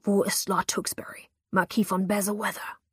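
whispered menace naming the lord; hissed demand. A narrator's reading. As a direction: spit the name out in a hiss. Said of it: "zischte er".

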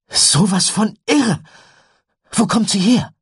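hohe, laute Begeisterung; aufgeregter Junge. A narrator's reading. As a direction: ruf es völlig begeistert. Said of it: "Tyler hüpfte aufgeregt". (aufgeregt und laut gerufen)

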